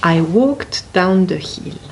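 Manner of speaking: ordinary delivery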